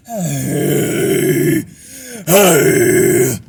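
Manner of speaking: loud rough airy yell; airy scream. Shown as a demonstration. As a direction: scream it on pure air. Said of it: "try taking all the air".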